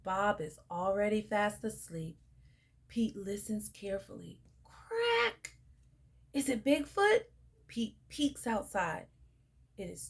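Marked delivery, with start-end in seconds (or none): 4.6-5.6 high sharp crack sound. A narrator's reading. Narrator imitating the snap in the woods, sharp crack. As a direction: snap it out like a branch.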